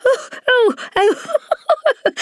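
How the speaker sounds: very high, shrieking giggles; roaring laughter. Acted. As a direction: laugh until it squeaks. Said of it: "a roar of laughter".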